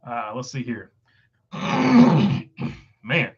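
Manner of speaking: strained, tight throat; strangled voice. Real, not acted. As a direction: squeeze words through a sore throat. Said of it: "cannot get my throat, uh, to, to cooperate".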